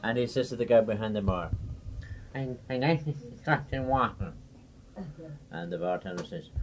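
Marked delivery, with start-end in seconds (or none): 2.3-4.3 lightly voiced halting speaker; speech impediment. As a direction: stumble through it with a speech defect. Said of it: "fun of the way I talk".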